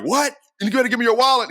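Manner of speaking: high-pitched, disbelieving retort; mock outrage at being refused; incredulous robber. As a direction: play a baffled mugger, voice jumping way up.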